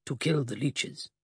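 muffled. Acted. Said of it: "came the muffled response".

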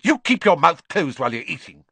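mother snaps at son to shut mouth, sharp and loud; snapped. A narrator's reading. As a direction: bite it off at her son. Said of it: "Missus Weasley snapped".

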